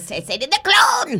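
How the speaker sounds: high-pitched character yell; mock movie yell. Actress correcting the interviewer's remembered movie line, high character yell. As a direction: pitch leaps way up, yelled in character. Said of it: "is you yelling".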